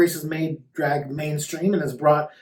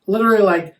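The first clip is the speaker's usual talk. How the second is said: ordinary talk, nothing marked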